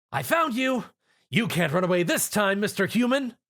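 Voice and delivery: high gleeful pounce cry; excited Lyra. A narrator's reading. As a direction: shout it as you pounce.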